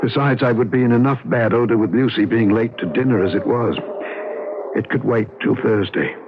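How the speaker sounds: calm, even storytelling voice; story narration. A narrator's reading. David recounting that night to the listener, reflective narration. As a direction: tell it looking back, measured.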